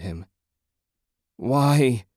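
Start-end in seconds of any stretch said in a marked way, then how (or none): 1.4-2.0 a high, loud anguished whine; grieving husband alone in the ruined room; whined. A narrator's reading. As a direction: wail the question out. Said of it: "he whined".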